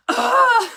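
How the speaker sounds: a high, loud exasperated groan breaking into a laugh; mock frustrated groan. Wife imitating her husband's angry groan while laughing. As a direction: groan like a fed-up patient, half laughing. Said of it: "he would get so mad and he'd be like".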